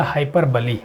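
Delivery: हाइपरबली said hai-per-buh-lee; four syllables in हाइपरबली, closing on "lee"; correct pronunciation. Shown as a demonstration. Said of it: "इसका उच्चारण ध्यान दीजिएगा".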